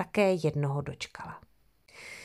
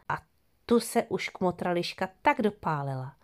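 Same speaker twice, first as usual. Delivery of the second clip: ordinary delivery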